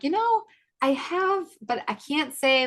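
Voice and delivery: high-pitched hedging, drawn out at the end; retold tentative answer. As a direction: re-enact a careful, noncommittal reply. Retells her own tentative answer.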